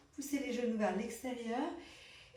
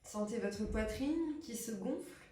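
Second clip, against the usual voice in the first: guided relaxation; 6 dB quieter than usual. Acted. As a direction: hush down and pace each breath cue. Leads breathing relaxation.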